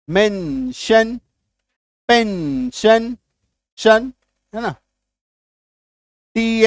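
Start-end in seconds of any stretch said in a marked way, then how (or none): 0.1-3.2 मेंशन and पेंशन each said slowly, ending in a clear "shan"; slow demo words. Shown as a demonstration. Teacher listing tion words for students, slow and deliberate. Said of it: "अटेंशन".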